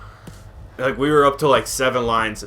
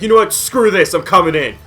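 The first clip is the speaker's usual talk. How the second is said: raised, higher voice of an irate caller; retold yelling; 6 semitones higher, 4 dB louder than usual. Angry caller threatening worker he's coming in, retold yelling. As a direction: act out a fed-up customer's loud threat. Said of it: "the guy's yelling at me".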